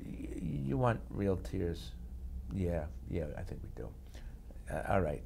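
asking the directors about real tears; hushed exchange. Retold or imitated. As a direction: ask it quietly, hesitant. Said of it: "I said".

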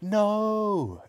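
high, drawn-out horrified no; crying out. A narrator's reading. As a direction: cry out in alarm. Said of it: "the other two cried".

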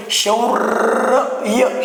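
शौर्य stretched very slowly so the र before य is heard; sounding out slowly. Shown as a demonstration. Teacher sounding out शौर्य very slowly for his class. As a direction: draw शौर्य out syllable by syllable. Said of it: "शौर्य म्हणून अगोदर रचा उच्चार".

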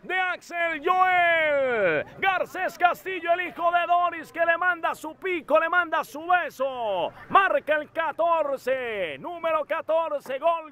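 high drawn-out goal shout; goal commentary. Real, not acted. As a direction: scream the goal, stretched out. Goal scored, commentator erupts.

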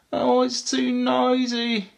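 high, slow little whine; acted whimper of an overwhelmed baby elephant. A narrator's reading. Baby elephant among honking horns, high whimper. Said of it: "she whimpered".

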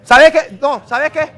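3 burst out at him; 1 sudden outburst. Real, not acted. 4 host cutting off the troublemaker, sudden loud outburst.

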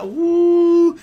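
high, loud sustained "ooh"; preacher's whoop. Real, not acted. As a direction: let out a long, high church whoop. Preacher overcome mid-sermon.

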